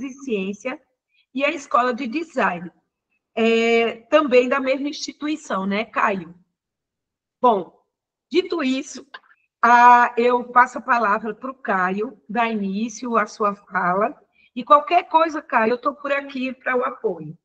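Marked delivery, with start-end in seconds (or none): none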